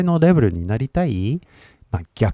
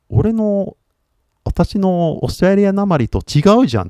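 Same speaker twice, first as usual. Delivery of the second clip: higher-pitched, taken-aback realisation; puzzled, raise the pitch; surprised learner; 8 semitones higher than usual. Pretend. Learner noticing another person's accent, surprised.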